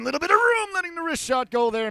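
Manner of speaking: sudden lift of real excitement at the chance; rising excitement. Real, not acted. Hockey commentator to listeners as a shot comes, jumping excitedly.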